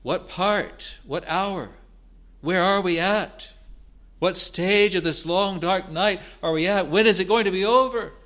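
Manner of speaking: high, loud, pressing questions; a suffering crier begging to know the hour; anguished pleading. Acted. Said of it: "So he's saying".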